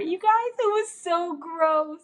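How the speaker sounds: high, slow drawn-out disgust; grossed out. Real, not acted. Telling viewers the nastiest part, high drawn-out disgust. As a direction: stretch it out, squealing at how gross it was.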